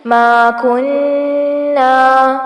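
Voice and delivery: slow ما کننا with a nasal held nn; ما کننا with ghunna. Shown as a demonstration. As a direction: draw out the nn of کننا through the nose. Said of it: "نون پر غنہ ہوگا".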